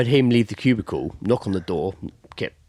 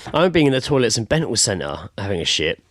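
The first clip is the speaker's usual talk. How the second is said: ordinary delivery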